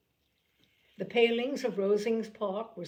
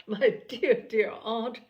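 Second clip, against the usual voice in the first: loud, thrilled outburst of joy; rapturous cry; 3 semitones higher, 6 dB louder, slower than usual. A narrator's reading. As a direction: burst out in delight to her aunt. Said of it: "she rapturously cried".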